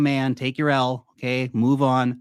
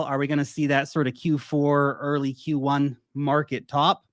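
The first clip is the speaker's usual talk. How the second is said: normal talking voice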